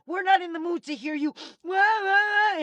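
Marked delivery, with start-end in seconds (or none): 1.6-2.6 high, louder mocking wah-wah whine; mock crybaby wail. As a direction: mimic a whining baby to ridicule them. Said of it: "cry, cry in a fucking corner".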